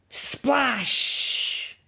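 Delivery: sharp splash word; high splash. Acted. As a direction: burst it out like water spraying. Man falls in sea.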